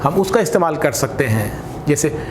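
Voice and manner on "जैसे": ordinary delivery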